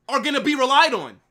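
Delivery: ordinary talk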